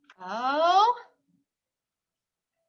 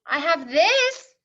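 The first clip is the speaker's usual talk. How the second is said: slow, higher, proud little voice; hopeful child; 5 semitones higher, 6 dB louder, slower than usual. Acted. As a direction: hold it up proudly. Rufus shows his book.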